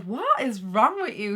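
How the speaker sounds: mock-exasperated, slow and loud; exasperated partner. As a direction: imitate a partner drawing out an incredulous question. Retells partner's exasperated question.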